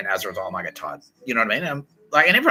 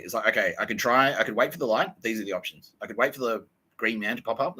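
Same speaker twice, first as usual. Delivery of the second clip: ordinary delivery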